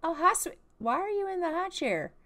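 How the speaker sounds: lightly voiced dad finding the kid stuck; a touch of baffled dad; surprised dad. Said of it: "And he's like".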